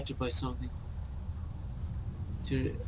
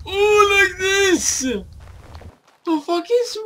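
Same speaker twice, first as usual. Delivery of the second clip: excited cry; 6 dB louder, much slower than usual. Real, not acted. Excited discovery mid-game.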